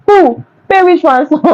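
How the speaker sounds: high, incredulous "who?"; mocking the kidnappers' hopes of a ransom, in high disbelief.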